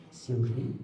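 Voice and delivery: soft, low call of the name; secretive hush. Shown as a demonstration. Teacher demonstrates secret news to a student, in a soft low voice. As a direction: draw her in as if sharing a secret. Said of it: "sangat rahasia".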